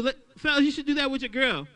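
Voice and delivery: ordinary talk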